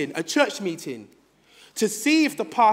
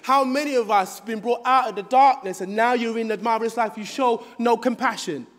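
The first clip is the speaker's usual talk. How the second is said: ordinary delivery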